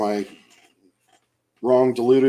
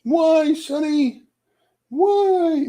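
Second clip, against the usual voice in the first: much higher cooing why to the cat, louder; baby talk; 7 dB louder, much slower than usual. Pretend. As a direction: coo at the cat.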